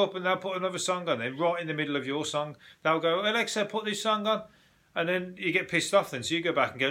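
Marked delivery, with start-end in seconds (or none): 3.2-4.4 higher-pitched mock command to Alexa; a hint of an interrupting guest; lightly imitated party guest. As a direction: mimic someone butting in, pitched up.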